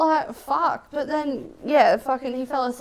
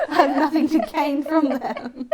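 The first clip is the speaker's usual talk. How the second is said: higher, slow, broken up by laughter; laughing through words; 6 semitones higher, 4 dB louder than usual. Real, not acted. Friend jokes about being too nice, laughing. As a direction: squeeze the line out while cracking up.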